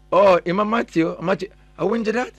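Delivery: ordinary delivery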